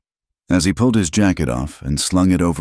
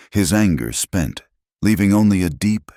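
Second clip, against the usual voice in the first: plain reading voice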